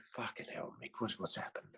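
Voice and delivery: soft, muttered disbelief; hushed mock whisper. Mocking friend at the church meeting, whispered. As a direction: whisper it so the congregation won't hear.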